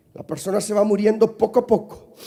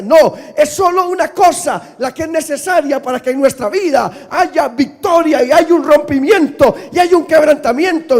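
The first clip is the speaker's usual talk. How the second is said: loud climbing sermon shout; preaching at full force; 6 semitones higher, 10 dB louder than usual. Acted. Pastor preaching to the congregation, shouting. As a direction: build it up to a roar.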